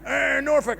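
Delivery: high pirate-farmer Norfolk voice; mock Norfolk accent. Comic mocking regional accents he confuses, hammed-up Norfolk accent. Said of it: "that weird pirate farmer hybrid".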